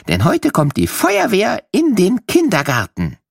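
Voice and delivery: ordinary delivery, nothing marked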